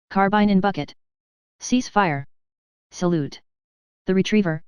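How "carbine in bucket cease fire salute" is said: usual speaking voice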